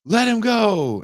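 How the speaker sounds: high, loud command; armed girlfriend's shout. Retold or imitated. Girlfriend holding a Glock orders him off, shouting. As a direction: yell it down a gun barrel. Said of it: "And she's like".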